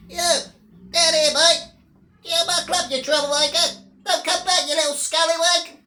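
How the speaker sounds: high, squeaky mock bouncer shouting. Mocking the small security guys, high mock bouncer yell. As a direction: play a tiny bouncer throwing someone out. Imitating tiny bouncer again.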